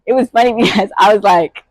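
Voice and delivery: ordinary delivery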